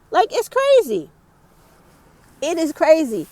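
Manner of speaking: outraged exclamation. Real, not acted. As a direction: burst out in disbelief.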